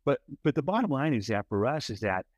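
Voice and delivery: usual speaking voice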